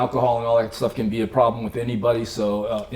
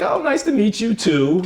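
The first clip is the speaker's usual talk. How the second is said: mock polite dismissal; 10 semitones higher, 4 dB louder than usual. Imitating Berta's polite dismissal.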